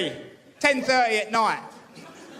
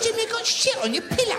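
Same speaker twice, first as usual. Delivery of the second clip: retold outburst; 11 semitones higher than usual. Retold exclaim to mate.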